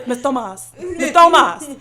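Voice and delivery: a light imitation of students calling the teacher; kids calling her name. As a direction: call her name like eager ESL kids. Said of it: "That's how they say my name".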